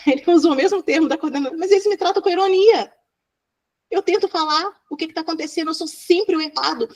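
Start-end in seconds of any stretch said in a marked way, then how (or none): 1.6-6.9 high-pitched mock protest; retold indignant complaint. Boy explaining his anger to the therapist, high-pitched protest. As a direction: plead the case like an aggrieved child.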